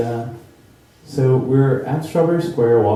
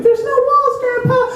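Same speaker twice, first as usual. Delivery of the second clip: very high, frantic cry; panicked movie kid; 5 dB louder than usual. Retold or imitated. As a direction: shout it like Charlie in the scary tunnel. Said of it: "like Charlie and the Chocolate Factory".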